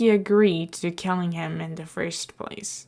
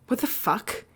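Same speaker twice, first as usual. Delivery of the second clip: blurted out; 3 dB quieter than usual. A narrator's reading. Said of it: "he blurted out without thinking".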